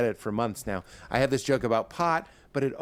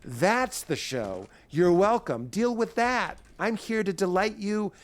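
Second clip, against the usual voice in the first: brash confident voice; 8 semitones higher than usual. Acted. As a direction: sell it like a fearless showman. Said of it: "confidence will say".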